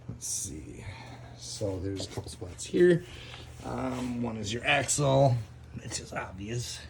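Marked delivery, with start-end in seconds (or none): none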